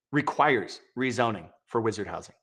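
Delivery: normal talking voice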